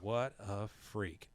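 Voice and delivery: slow, soft mock disgust; lightly acted appalled girl; drawn-out disdain. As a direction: stretch out each word with quiet scorn. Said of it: "And she goes".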